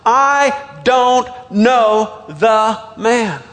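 slow, one word at a time; swearing denial. Acted. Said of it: "he swore to them".

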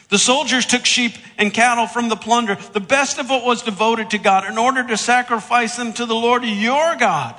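high, loud defensive whine; hammed-up whiny self-justifying; whiny excuse. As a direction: blame the soldiers in a pleading rush of protest. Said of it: "you can just kinda hear a whiny voice".

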